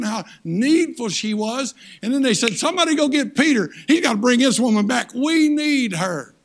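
loud, high, fervent preacher's cry; loud preaching, slowing at the end; full-force preaching. Acted. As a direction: preach it out to the back pews.

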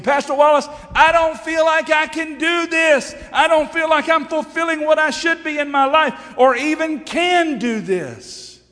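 high, loud protest to the pastor; shouted, high-pitched complaint, dragging out the last words; mock congregant outcry.

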